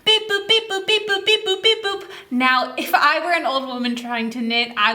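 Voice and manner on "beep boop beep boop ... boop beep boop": as fast as you can, high and chirpy; fast beeping. Acted. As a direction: rattle off the beep boops quickly. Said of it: "as fast as you can".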